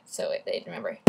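usual speaking voice

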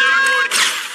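very high, loud jeering shout; scornful yell. Acted. Taunting Morty with his sister, shouting. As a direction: blast the insult at him. Berating Morty in mocking chorus.

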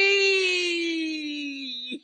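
high wailing fake cry; hammed-up sobbing wail; mock sob. Comic fake-crying over his apology, loud wail.

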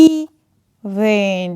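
ইভেন split slowly, "eee... ven"; long then short. Shown as a demonstration. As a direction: hold the first vowel of ইভেন, pause, short second syllable. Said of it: "long, এটা short হয়ে গেল". (ইভেন ভেঙে, প্রথমে টানা ই)

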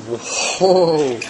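ordinary delivery

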